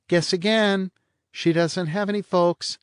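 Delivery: high, languid teasing woman; bored blonde. A narrator's reading. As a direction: toss it off, lazily mocking.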